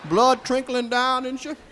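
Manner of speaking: usual speaking voice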